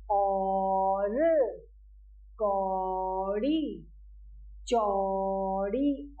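each word stretched very slowly and clearly; slow drilled words. Shown as a demonstration. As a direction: draw out every word for children to repeat. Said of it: "हम इसका उच्चारण भी औ करके करेंगे". (बच्चों के लिए बहुत धीरे, साफ़ उच्चारण)

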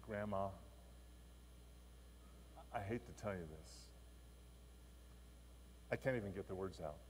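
a hint of confessing through tears; boy confessing to grandma, lightly tearful; tearful confession. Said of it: "He's crying".

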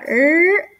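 "er" held with tongue curled up; English R sound. Shown as a demonstration. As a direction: hold "er" without touching the palate. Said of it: "gírala para la parte de arriba".

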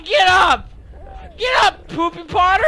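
impatient shouted command; bossy yell. Pretend. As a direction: yell at a fallen teammate. Yells at fallen friend.

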